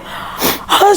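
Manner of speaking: overwhelmed gasp. Real, not acted. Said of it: "I couldn't talk".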